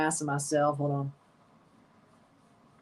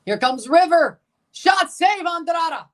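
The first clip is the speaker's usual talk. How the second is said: much higher and louder, calling the shot and save live; excited shout; 10 semitones higher, 5 dB louder than usual. Real, not acted. Streamer reacting live to a shot on goal, excited shout. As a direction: yell it out as the keeper makes the save.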